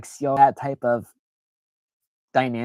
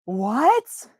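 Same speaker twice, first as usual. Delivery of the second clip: much higher, incredulous; amazed squeak; 11 semitones higher, slower than usual. Retold or imitated. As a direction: squeak it, stunned someone cares. Retold touched reaction.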